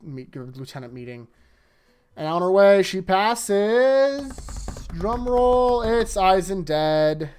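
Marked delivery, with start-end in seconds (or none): 2.1-7.3 loud, high announcer-style build-up; dramatic reveal. Acted. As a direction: draw out the suspense, loud and raised.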